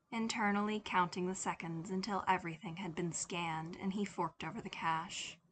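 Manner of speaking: ordinary delivery, nothing marked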